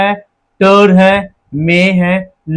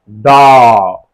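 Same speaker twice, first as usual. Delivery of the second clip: डॉग stretched out long as one beat; one long syllable; 6 semitones lower, 4 dB louder, much slower than usual. Shown as a demonstration. Teacher showing the mouth opens once, डॉग drawn out. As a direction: hold the vowel of डॉग, one slow opening. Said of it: "एक बार खुला".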